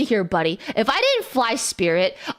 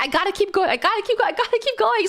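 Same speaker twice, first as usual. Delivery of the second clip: rising urgency; 7 semitones higher, much faster than usual. Acted. Repeated, climbing mantra.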